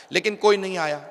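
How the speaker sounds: ordinary talk, nothing marked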